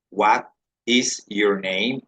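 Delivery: "What is your name?" said slowly, each word separate; careful teacher model; slow full form. Shown as a demonstration. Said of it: "se dice".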